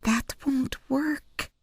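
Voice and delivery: put-on high, slow child's voice, hushed; a small girl quietly certain; little girl whispering. A narrator's reading. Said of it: "whispered Penelope".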